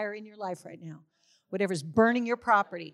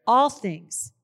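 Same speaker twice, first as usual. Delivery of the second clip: proclaiming loudly; 3 semitones higher, 8 dB louder than usual. Real, not acted. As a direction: ring out the words all things like a declaration. Declaring scripture to congregation.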